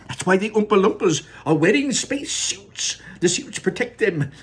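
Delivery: high, loud, urgent Wonka voice; frantic showman shooing kids from camera; Mister Wonka. Acted. Wonka warns Mike Teavee back from the camera, high and loud.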